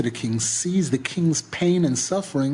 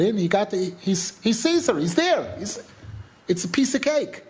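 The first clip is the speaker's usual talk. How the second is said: higher-pitched, animated amazement at how easy; excited ease; 5 semitones higher than usual. Real, not acted. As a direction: build up excitedly, pitch climbing. Stressing how easy entry was.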